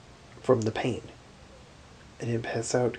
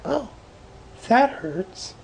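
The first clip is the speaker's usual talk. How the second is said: mock understated complaint; 12 semitones higher, 6 dB louder, much slower than usual. Shown as a demonstration. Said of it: "you can't just go".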